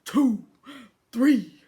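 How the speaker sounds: loud, forceful count, each number punched out; stern counting. A narrator's reading. Husband counting for his wife in labour, stern and loud. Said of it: "her husband sternly counted".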